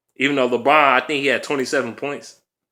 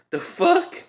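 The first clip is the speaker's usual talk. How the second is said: very high disbelieving the fuck; incredulous squeak. Real, not acted. Incredulous fan after laughing.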